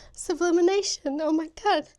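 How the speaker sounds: high, loud burst of emotional amazement; overcome with emotion. Real, not acted. Crafter seeing her first sublimation, overcome with emotion. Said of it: "getting so emotional".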